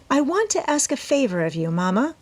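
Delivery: put-on young daughter asking her mother a favor; Amy's voice. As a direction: ask sweetly, like a young girl wanting something. Amy asks her mother a favor.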